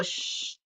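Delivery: put-on soft whispered shh for a baby; soothing shush. Shown as a demonstration. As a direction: hush a crying baby gently. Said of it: "we naturally go".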